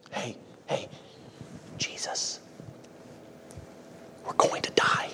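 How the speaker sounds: hushed whisper, pretending to wake someone gently; mock timid whisper. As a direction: whisper the alarm as if afraid to disturb. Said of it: "that's how everybody would react".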